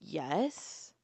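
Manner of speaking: soft, hesitant "Yes."; reluctant. Retold or imitated. Asked by the officer to press charges, she answers reluctantly. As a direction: agree with no heart in it.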